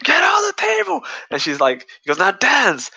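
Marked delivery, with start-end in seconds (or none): 0.0-1.0 much higher, louder yelled command; retold shouted order. Villain forces girl onto table. 2.2-2.9 higher, louder menacing command; retold shouted order. Villain orders her to dance.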